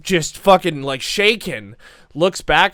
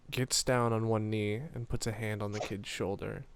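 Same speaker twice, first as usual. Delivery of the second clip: soft, low storytelling voice; hushed intimate aside; 4 semitones lower, 11 dB quieter than usual. Acted. Winifred kneeling to the kid, hushed and low. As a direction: keep it close and very quiet. Game master's hushed build to line.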